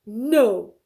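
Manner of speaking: loud flat refusal; bear's loud no. Acted. Bear refusing rabbit, loud. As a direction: bark a loud no. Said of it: "what do you think Bear said".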